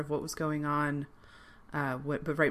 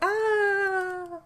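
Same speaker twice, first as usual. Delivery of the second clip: high yelp; 7 dB louder than usual. Retold or imitated. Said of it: "people are like".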